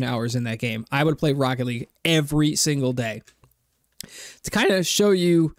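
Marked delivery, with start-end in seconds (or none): 4.4-5.5 voice crack. Real, not acted. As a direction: voice cracking on the rise. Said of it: "whoa, crazy voice crack".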